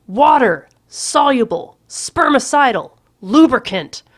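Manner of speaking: Water-soluble spermicidal lubricant bellowed slowly; shouting. A narrator's reading. Patrick shouting the word at Courtney, loud and slow. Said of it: "I'm shouting this".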